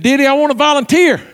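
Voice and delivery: eager volunteering. Retold or imitated. Woman offering to help her pastor, eagerly. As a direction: imitate an eager volunteer piping up.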